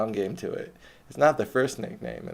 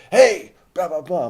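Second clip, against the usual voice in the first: big booming shout, much higher and louder; booming yell; 9 semitones higher, 14 dB louder, slower than usual. Retold or imitated. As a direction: bellow it across the park like a labor organizer. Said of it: "a big booming voice".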